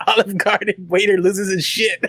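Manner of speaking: headline squeezed out through real laughter; laughing. Real, not acted. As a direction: crack up while reading out the title. Cracking up at own joke headline.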